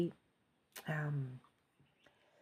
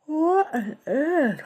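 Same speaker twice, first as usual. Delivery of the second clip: high, loud, slow and stretched through a yawn; yawning exclamation; 11 semitones higher, 8 dB louder, much slower than usual. Real, not acted. Psychic caught by a huge yawn on camera, yawning. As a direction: let the yawn pull the words out long. Sudden big yawn mid-reading.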